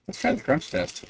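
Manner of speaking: high, soft self-quote. Retold or imitated. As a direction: lift the voice for the quote. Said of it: "I was like".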